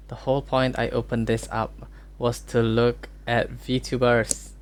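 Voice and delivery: ordinary talk, nothing marked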